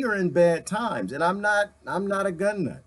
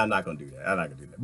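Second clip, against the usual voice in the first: quiet aside; 11 semitones lower, 7 dB quieter, much faster than usual. Real, not acted. Lowered voice, repeated aside.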